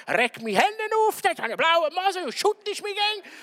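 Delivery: high, loud mock rant; a hammed-up angry nag; angry spouse. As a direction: play the fed-up spouse ranting at home. Acted angry spouse on way home.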